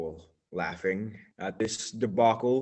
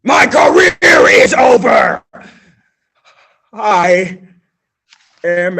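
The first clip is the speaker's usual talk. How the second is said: loud wail of despair; despairing shout; 9 semitones higher, 20 dB louder than usual. Acted. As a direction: cry it out to the heavens. Character despairs loudly.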